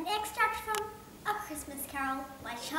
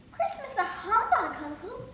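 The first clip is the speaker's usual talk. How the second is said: put-on higher, slow, amused young nephew; cheerful nephew; 8 semitones higher, 7 dB louder than usual. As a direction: tease the grumpy uncle.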